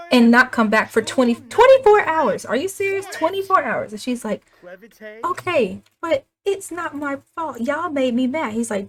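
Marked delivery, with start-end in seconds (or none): 0.1-3.9 high, outraged telling-off; mock parental yelling. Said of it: "we are going to yell at her". 5.2-8.5 high, whiny acted back-talk; teen protest. Scolded teen protests to parents.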